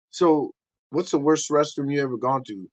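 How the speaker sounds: normal talking voice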